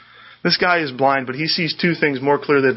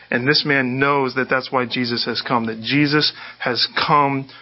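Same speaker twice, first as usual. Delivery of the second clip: ordinary delivery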